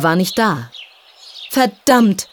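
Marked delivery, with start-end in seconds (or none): 1.5-2.3 higher, louder breathless curse; a boy swearing after a sprint; cursing, out of breath. A narrator's reading. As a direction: spit it out while panting from running. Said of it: "fluchte er völlig außer Puste".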